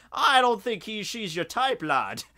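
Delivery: a hint of a Scottish father teasing; lightly Scottish doubtful dad. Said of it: "the Scotsman's just like".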